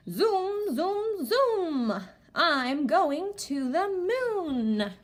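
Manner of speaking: high zooms and a slow moon line; excited read-aloud. Shown as a demonstration. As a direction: read it like an adventure for kids. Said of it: "Let's keep going".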